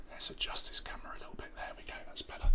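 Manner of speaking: fast whispered aside, away from the mic. Real, not acted. Host adjusting his camera, whispering to himself. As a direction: whisper quickly while fiddling with the camera.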